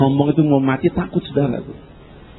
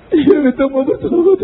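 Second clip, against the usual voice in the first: high, mock-desperate yes; frantic giving in; 13 semitones higher, 3 dB louder than usual. Dying man agrees to repent, frantic giving in. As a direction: give in with a raised, panicked voice.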